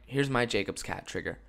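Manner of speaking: usual speaking voice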